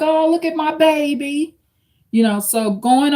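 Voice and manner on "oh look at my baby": lightly imitated doting mom, higher. Mimicking the mother praising her son, light doting coo. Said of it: "She probably was the mom, like".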